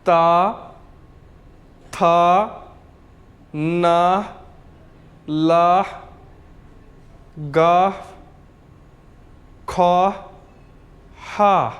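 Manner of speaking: ता, था, ना, ला, गा, खा, हा each said alone; repeat-after-me syllable drill. Shown as a demonstration. Teacher leading students to repeat, isolated syllables from ता to हा. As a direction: drill ता through हा as separate held syllables, long pauses between. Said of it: "मेरे साथ अब आप लोग सारे रिपीट करें".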